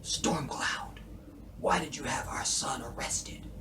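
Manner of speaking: soft breathy whisper; David's whisper. Retold or imitated. Imitating David whispering to Stormcloud on the phone. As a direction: whisper it like a spent man. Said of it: "So he calls Stormcloud".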